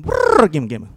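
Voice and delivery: loud rolled brrr, then falling. Acted. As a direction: roll the r loudly, then drop. Performs the sound poem.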